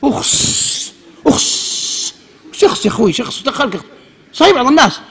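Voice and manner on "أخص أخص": تقليد اشمئزاز همسًا; mock disgusted hiss. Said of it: "لا تقول".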